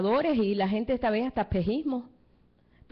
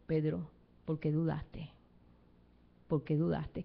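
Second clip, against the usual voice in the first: soft, slow, lower tender reproach; gentle rebuke; 6 semitones lower, 5 dB quieter than usual. Acted. Jesus gently asks doubting Peter why he doubted, soft and slow. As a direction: chide him softly like a loving teacher.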